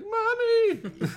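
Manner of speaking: put-on very high loud child's wail; mock scared cry. Scared child running to mom, high loud cry. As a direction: shriek for mom like a terrified kid.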